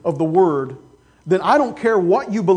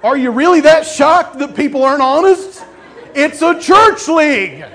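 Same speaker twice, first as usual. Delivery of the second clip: laughing disbelief; 6 semitones higher, 5 dB louder than usual. Real, not acted.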